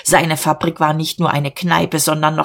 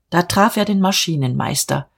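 usual speaking voice

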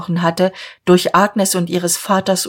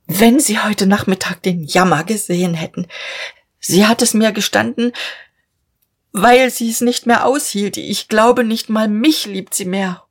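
higher, strained pleading in a changed voice; holding back sobs; 4 semitones higher than usual. A narrator's reading. Father pleads for his daughter, choking back sobs. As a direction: plead through suppressed tears. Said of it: "das Schluchzen unterdrücken".

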